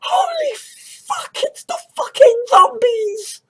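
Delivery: high terrified yell; panicked shout. Acted. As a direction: scream in horror at the crowd. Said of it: "the people who are manning the borders are like".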